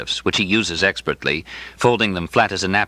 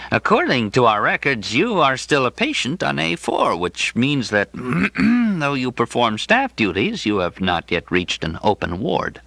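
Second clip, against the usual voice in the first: higher, genial voice of the coughing clinic director; amiable director; 6 semitones higher than usual. Acted. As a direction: play the bulging-eyed director, kindly and a bit vague.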